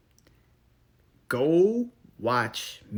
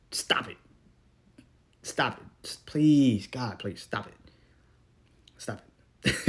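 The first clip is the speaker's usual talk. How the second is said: pleading stop. Real, not acted.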